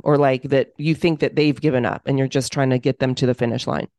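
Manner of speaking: ordinary talk, nothing marked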